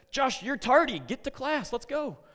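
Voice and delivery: high, loud sharp desk clerk; scolding front desk. Retold or imitated. Front desk sending tardy Josh to class, high and loud.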